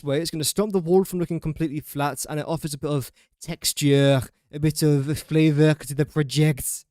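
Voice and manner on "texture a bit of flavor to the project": slow put-on Chinese accent; jokey Chinese accent. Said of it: "uh, Chinese accent".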